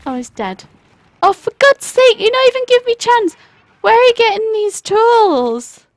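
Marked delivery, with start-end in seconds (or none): 1.2-5.9 high, loud exasperated outburst; frustrated shouting. Real, not acted. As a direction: rant at the enemy at full volume. Frustrated at the game.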